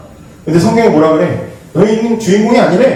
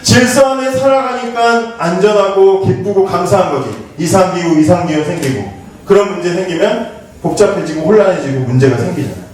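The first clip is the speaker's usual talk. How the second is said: ordinary delivery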